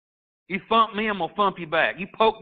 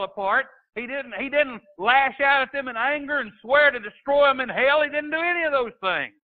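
higher and higher, building sermon fervour; rising preaching; 5 semitones higher than usual. Acted. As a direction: preach it up toward the cross. Preaching builds to full force.

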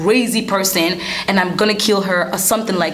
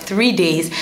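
usual speaking voice

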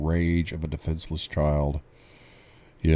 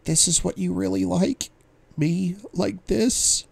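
hurt woman's voice; 14 semitones higher than usual. Acted. Her remembered words, voiced female.

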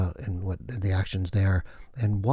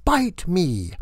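witch voice; 9 semitones higher, 4 dB louder than usual. Acted. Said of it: "she said".

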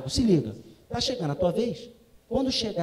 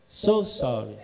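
much lower, mock apologetic; lightly voiced princess; 9 semitones lower, much slower than usual. Princess apologizing to dad, low mock apology. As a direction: say it deep and sorry. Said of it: "Ela falou".

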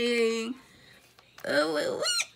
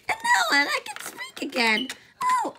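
high squeaky voice. Pretend.

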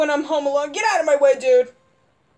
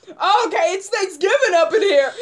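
ordinary delivery

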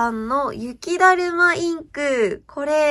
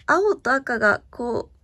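ordinary talk, nothing marked

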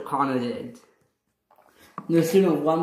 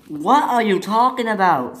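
higher, louder denial; indignant protest; 6 semitones higher, 5 dB louder than usual. Acted. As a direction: protest the accusation. Twins protest an accusation.

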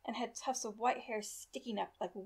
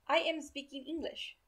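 put-on German-accented English, pitched higher; thick accent; 5 semitones higher than usual. A narrator's reading. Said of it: "in his thick accent".

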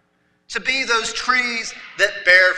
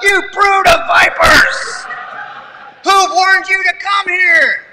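higher, louder, slow hammed-up shout at the couple; mock shout; 9 semitones higher, 8 dB louder than usual. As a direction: blast the young parents like a fiery prophet. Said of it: "Matthew just shouts out".

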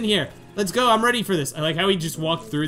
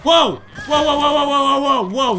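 loud, high, rapid-fire exclamations; startled whoas; 7 semitones higher, 5 dB louder than usual. Real, not acted. Player startled by students messing with their friend, startled shouting. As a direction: blurt a run of alarmed whoas.

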